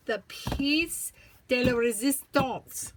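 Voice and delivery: mock French accent, pushed loud and playful; really bad French accent. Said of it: "a really bad French accent".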